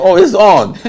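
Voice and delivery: loud, high, thrilled exclamation; overjoyed, it's happening; excited. Retold or imitated. Telling his roommate the news, loud and thrilled.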